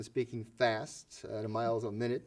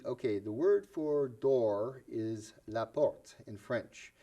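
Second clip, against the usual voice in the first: slow translating; much slower than usual. Shown as a demonstration. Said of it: "For example, I will say".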